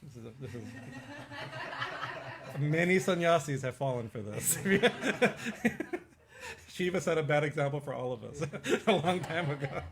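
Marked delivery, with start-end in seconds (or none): 0.7-2.5 sudden high burst of laughter; bright giggle breaking out. Real, not acted. Laughing at own joke. 4.6-6.0 loud high laugh bursting out; loud amused cackle. Real, not acted. Laughing at own joke.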